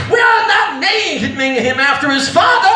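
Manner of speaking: high, slow, hammed-up angry yell; mad mother. Mother refuses the name bastard, mock angry shout. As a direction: stretch each word in mock maternal fury. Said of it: "my mother got all mad".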